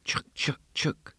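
soft unvoiced chuk chuk; whisking sound. A narrator's reading. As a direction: click it out like a spoon in a basin. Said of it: "she heard a sound repeated".

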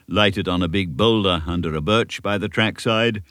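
ordinary delivery

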